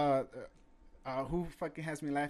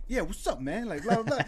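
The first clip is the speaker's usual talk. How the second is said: higher-pitched put-on greeting; cartoon impression, pitched up; put-on professional voice; 7 semitones higher, 5 dB louder than usual. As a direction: imitate a phony friendly business voice. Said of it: "how people talk from their, uh, professional voice".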